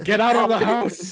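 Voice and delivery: high, loud play-panicked yell; mock alarm shout. Joking that the clock is a fire alarm, mock panicked shout. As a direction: yell it like a hammed-up emergency warning.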